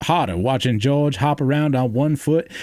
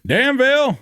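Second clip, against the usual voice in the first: loud, high call of a station name; conductor hollering; 10 semitones higher, 3 dB louder than usual. Retold or imitated. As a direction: holler it down the platform. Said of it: "the conductor hollered".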